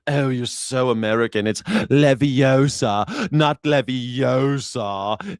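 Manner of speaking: slow, drawn-out posh British mockery; mock British correction. Brits correcting an American, mock British accent. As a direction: drag out Leviosa like a smug Brit. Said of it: "They're like".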